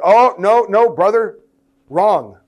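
loud, high, mock rebuke of a brother; hammed-up loud church scold. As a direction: play the know-it-all who cuts people off. Mock scolding of disagreeing listener.

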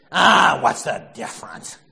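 loud, gruff brush-off; gruff dismissive husband. Retold or imitated. Archie brushing off his wife, gruff and loud. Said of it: "And he says".

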